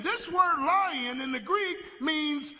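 high, loud emphatic explanation; shouted, with weight on "lion"; preaching shout. Acted. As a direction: drive the point home hard. Preaching the Greek meaning.

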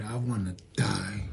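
despairing. Retold or imitated. Elijah's despair voiced.

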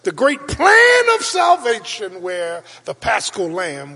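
high, loud preacher's cry; preaching shout. Acted. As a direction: ring it out to the congregation. Preacher climaxes over music.

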